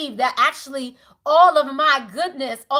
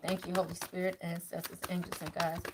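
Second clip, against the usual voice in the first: much softer, hushed thanks to the spirits; murmured prayer; 8 semitones lower, 16 dB quieter than usual. Acted. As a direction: pray under your breath, inwardly.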